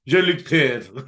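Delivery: slow, clipped "Jean-Luc Picard" that drops letters; hammed-up French "Jean-Luc Picard"; mock French. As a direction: drawl the name like a lazy Frenchman. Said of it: "in proper French".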